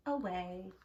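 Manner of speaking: ordinary delivery, nothing marked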